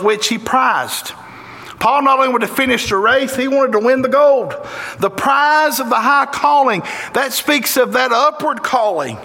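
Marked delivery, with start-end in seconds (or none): none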